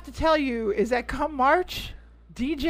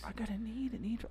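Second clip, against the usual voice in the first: very soft, lower recitation; under the breath; 3 semitones lower, 18 dB quieter, faster than usual. Real, not acted. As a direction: murmur the line to yourself while recalling it. Recalls movie line under the breath.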